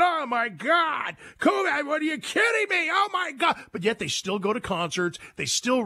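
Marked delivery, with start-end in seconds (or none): 0.0-3.6 high, shrill-pitched mock panic; mocking the left's freak-out, much higher and shouty; mocking panicked leftist. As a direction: ham up a hysterical critic. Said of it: "They're freaking out, saying".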